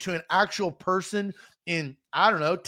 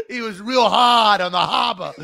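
loud mock Boston accent; 7 dB louder than usual. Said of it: "like, a Boston accent?".

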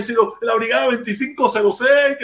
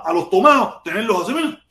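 ordinary talk

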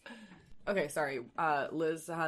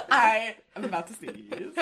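louder and higher, laughing through it; 8 semitones higher, 10 dB louder than usual. Real, not acted. Host laughing while about to sneeze. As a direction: giggle out the warning.

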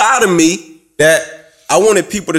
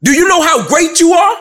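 fired-up pep talk; 9 semitones higher than usual. Retold or imitated. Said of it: "my press was to my peer".

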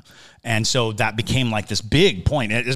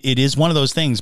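ordinary delivery

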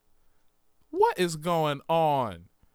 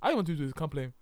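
playful mock Ghanaian accent; put-on Ghanaian accent; faster than usual. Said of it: "what accent you do".

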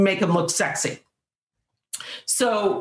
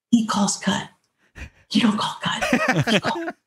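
usual speaking voice

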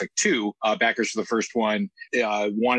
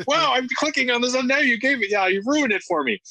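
whiny backer voice; 13 semitones higher than usual. Retold or imitated.

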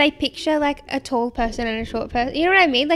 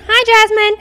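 excited greeting; 9 semitones higher, 10 dB louder, slower than usual. Real, not acted. Greeting a listener.

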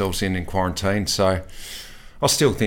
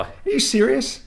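high, incredulous question at usual volume; amused disbelief; 14 semitones higher than usual. Real, not acted. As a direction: ask it again in sheer disbelief. Teammate still on the phone.

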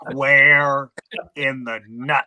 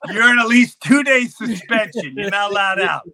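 urgent shout; 6 semitones higher, 4 dB louder than usual. Retold or imitated. And we're going.

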